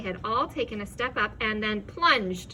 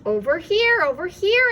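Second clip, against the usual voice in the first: higher, loud beckoning; calling advice; 8 semitones higher, 9 dB louder than usual. A narrator's reading. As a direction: wave them over by voice. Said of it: "advised the voice".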